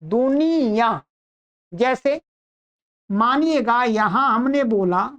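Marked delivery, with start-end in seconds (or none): 0.0-1.0 slow "दुनिया" ending in nasal -yaan. Shown as a demonstration. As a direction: stretch "दुनिया" and send its final vowel through the nose. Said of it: "नासिका से भी बोल रहे हैं".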